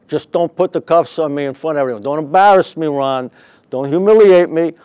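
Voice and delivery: loud, high begging; mock pleading. Manager begs the detective not to cuff him, loud pleading. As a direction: beg not to be shamed, hammed up.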